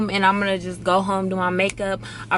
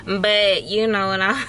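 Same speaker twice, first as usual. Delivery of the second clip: usual speaking voice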